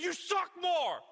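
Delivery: high, loud, strained mock comeback; mock retort. Other person firing back in the fight, mock yelled retort. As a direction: throw it back even harder.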